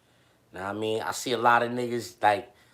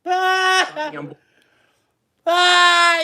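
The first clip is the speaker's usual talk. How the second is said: laughing; 11 dB louder than usual. Real, not acted. Cracking up at the clip.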